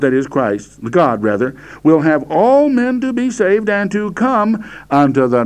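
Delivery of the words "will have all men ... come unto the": "all" launched high and held; stress on all. Shown as a demonstration. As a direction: push "all" out, keep the verse lifted. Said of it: "I'm emphasizing the word all here".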